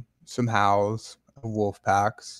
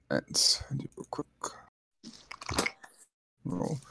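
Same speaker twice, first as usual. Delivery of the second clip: whispering; 3 semitones higher, 11 dB quieter than usual. Real, not acted. Aside to the table before a roll.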